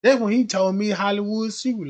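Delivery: normal talking voice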